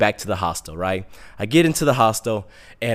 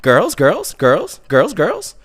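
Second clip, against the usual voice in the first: repeated hawker's chant, ending high; imitated hustler's patter; street touts; 5 semitones higher, much slower than usual. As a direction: tout it to passers-by on a rising tune. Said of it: "I have these guys come out".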